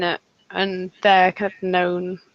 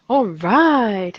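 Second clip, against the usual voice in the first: drawn-out imitation of a lazy host; mock wedding DJ; 2 semitones higher, 4 dB louder than usual. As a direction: tease him with a slow DJ drawl. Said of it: "You kinda go".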